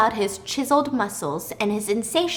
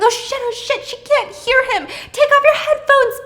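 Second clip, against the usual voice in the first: much higher and louder, frantic yelling; panicked scream; 13 semitones higher, 5 dB louder than usual. Retold or imitated. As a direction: scream at a neighbor who can't hear. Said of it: "So Pippa's screaming".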